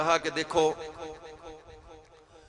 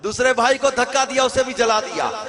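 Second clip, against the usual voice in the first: loud, high, building sermon cadence; fiery preaching; 5 semitones higher, 6 dB louder than usual. Acted. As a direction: hammer each line home. Preaching at full force. (پرجوش خطابت)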